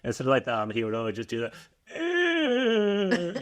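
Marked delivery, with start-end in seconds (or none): 2.0-3.4 high, squeezed "Eh" imitating a programmer; mock the coworker's filler with a pinched, high "Eh"; Eastern European coworker impression. Recalling the friend's impression of a coworker, high pinched "Eh". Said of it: "this funny impression of".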